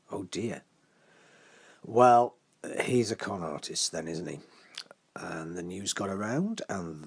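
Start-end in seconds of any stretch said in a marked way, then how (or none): none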